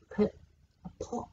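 usual speaking voice

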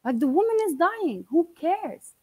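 defending the dying aunt against mom, heated; angry plea. Retold or imitated.

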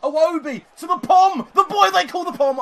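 loud, high, thrilled play-by-play call; hyped-up commentator roar; excited commentary shout. Real, not acted. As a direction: yell the build-up, pitch way up.

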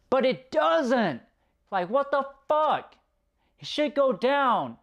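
high-pitched, incredulous complaint; real fed-up outburst, pitched high; exasperated. Real, not acted. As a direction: protest it in rising disbelief, pitch well up. Exasperated rant at a film flaw.

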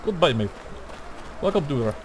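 mock Russian accent; light put-on Russian accent. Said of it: "why am I speaking in a Russian accent".

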